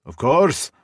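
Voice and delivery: bustling Scottish barkeep offering a drink; Scottish brogue. Acted. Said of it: "her thick Scottish brogue".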